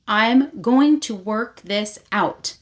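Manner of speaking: "I'm going to work this out" slowly, word by word; slow confronting emphasis. Retold or imitated. Vea confronts Rave, "I'm going to work this out" slow and emphatic. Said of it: "finally confronts her and says".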